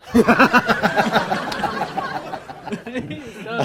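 long real burst of laughter. Real, not acted. Teller laughing at his own joke. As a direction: laugh hard at the punchline.

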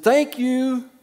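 high, sing-song mock-polite thank you; sarcastic thanks. Pastor to rude clerk, high mock-polite thanks. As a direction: sweeten it with fake politeness. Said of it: "I said".

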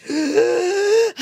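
gasp, hurt and startled; sharp pained gasp. Acted. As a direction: gasp for air. Zelda near death.

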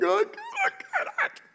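very high mock whine, drawn out slowly; hammed-up tearful frustration; nearly crying. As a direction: imitate a child on the verge of tears. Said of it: "He almost starts to cry".

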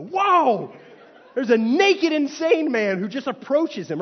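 loud, high, laughing shock; startled whoa. Retold or imitated. Tourist in Toronto startled by a naked man, laughing. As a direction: jump back in alarm with a laugh.

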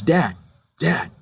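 lightly voiced small child; a tugging little boy calling for his father; little son. Toddler son tugging at dad for attention, childlike. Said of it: "he had a little bit of a lisp".

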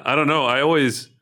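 ordinary talk, nothing marked

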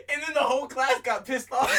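high, loud, through real laughter; laughing. Real, not acted. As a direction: crack up while telling it. Cracking up at own story.

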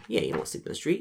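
normal talking voice

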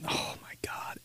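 breathy whispered oh my God; whispered exasperation. Real, not acted. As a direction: hiss it under your breath. Exasperated aside.

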